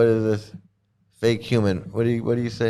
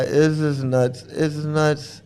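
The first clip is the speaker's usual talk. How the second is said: ordinary talk, nothing marked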